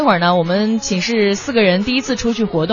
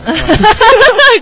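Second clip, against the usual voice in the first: loud high burst of laughter; laughing; 12 semitones higher, 7 dB louder than usual. Real, not acted. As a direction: crack up loudly. (放声大笑)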